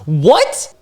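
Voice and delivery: taken aback and high; incredulous. Real, not acted. Reacting to sudden flirt.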